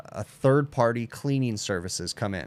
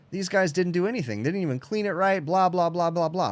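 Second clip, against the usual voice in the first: mock griping tech; 7 semitones higher than usual. Acting out griping techs.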